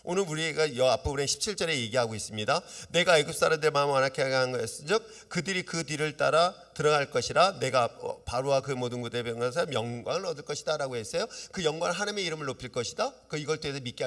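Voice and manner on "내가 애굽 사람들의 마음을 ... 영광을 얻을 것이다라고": loud, measured reading of a Bible verse; reading scripture aloud, louder. Acted. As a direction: proclaim the verse to the congregation.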